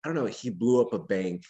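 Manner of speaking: usual speaking voice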